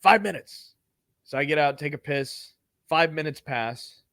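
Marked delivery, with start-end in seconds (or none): 0.0-0.7 higher, clipped order to the passengers; curt driver. Retold or imitated. Driver giving passengers a break, curt and higher. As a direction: bark the time limit. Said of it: "He goes".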